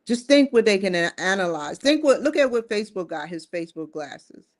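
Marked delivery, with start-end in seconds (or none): none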